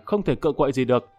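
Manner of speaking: ordinary delivery, nothing marked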